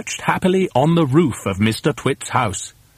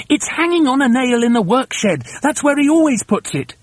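high-pitched hurried instruction; urgent cry; 11 semitones higher, 3 dB louder than usual. Acted. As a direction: call out directions urgently from the cage. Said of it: "cried Mugglewump".

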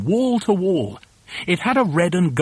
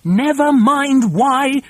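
high, loud, drawn-out order; slow, emphatic high shout; commanding shout; 9 semitones higher, 5 dB louder, much slower than usual. A narrator's reading. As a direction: shut down the question loudly. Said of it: "shouted Mugglewump".

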